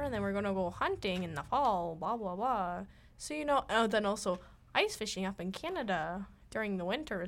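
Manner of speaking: hammed-up northern Minnesotan accent, bouncy tune; singsong Minnesota grandpa patter; old Minnesotan grandpa. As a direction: do a bad impression of a Minnesotan grandpa. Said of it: "that's my impression of, of an old grandpa".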